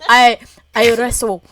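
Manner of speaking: put-on loud, halting try at a Scottish accent. Friend retrying the bear boast, wobbly Scottish accent. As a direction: feel for a Scottish accent, word by word. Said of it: "You're literally Scottish".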